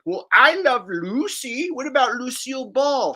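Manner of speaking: lightly voiced know-it-all defending Lucille Ball; a hint of a prissy fan correcting you; mock objector. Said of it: "somebody who'll wanna bring up".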